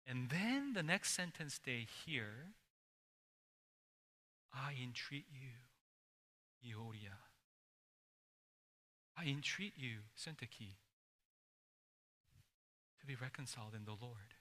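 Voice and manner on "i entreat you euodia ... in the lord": much softer, near-whispered entreaty; hushed reading. Acted. Letter read aloud to a stunned church, hushed. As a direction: let the plea fall into a hush. And you could hear a pin drop.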